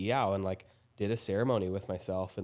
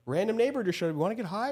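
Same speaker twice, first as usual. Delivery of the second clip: much higher, hammed-up eager invitation; mock the easy temptation, pitched way up; 13 semitones higher, 5 dB louder than usual. Mocking how her old self invites a neighbour to get high, much higher.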